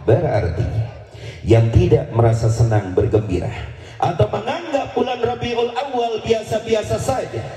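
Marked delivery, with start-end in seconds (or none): 4.0-7.4 high, fervent sermon voice; full-force preacher declaiming; fiery preaching. Acted. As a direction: preach it out with rising fervor.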